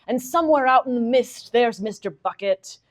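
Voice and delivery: a lightly hammed-up storybook narrator voice, slowing at the end; mock grand novel narration. Reading a mock line from the novel to the audience, lightly hammed-up narration. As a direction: read it like a solemn Victorian narrator, then undercut it. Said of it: "there'll be a line like".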